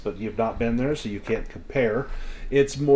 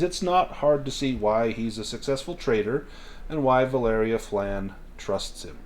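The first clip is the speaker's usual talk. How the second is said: normal talking voice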